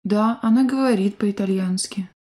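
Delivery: ordinary delivery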